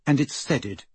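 ordinary delivery, nothing marked

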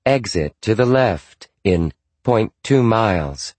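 flat robotic navigation voice; computerized GPS voice. Acted. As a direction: read it like a car GPS. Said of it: "a woman's computerized voice".

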